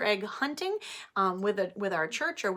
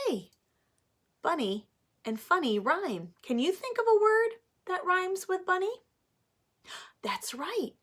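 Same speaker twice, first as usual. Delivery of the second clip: put-on reading to children; 3 semitones higher, 4 dB louder than usual. Teacher reading Pete the Cat aloud to her class, animated. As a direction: read it like story time for little ones.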